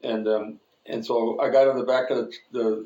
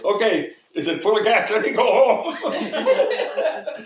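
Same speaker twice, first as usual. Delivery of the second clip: high, loud joking outburst, last words stretched; mock excitement; 9 semitones higher, 6 dB louder, much slower than usual. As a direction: crack yourself up hamming the line. Acts out his joke in fake car.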